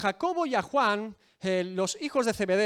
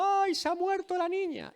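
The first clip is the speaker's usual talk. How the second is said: much higher, hammed-up lament; mock wailing mourner; 11 semitones higher than usual. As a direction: imitate a hired mourner's wail. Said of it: "los endechadores llorando". (plañidera fingida, lamento mucho más agudo)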